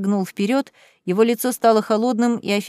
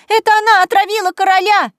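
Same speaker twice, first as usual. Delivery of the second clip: high, loud raging shriek; hysterical accusation; 10 semitones higher, 7 dB louder than usual. Acted. Cousin accusing Kaitlyn of poisoning the king, raging shriek. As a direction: scream it for the whole hall.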